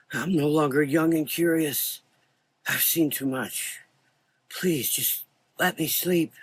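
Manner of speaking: put-on high, tired old voice; weary old man. A narrator's reading. Old doctor begs Frank to let him sleep. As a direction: beg to be let rest.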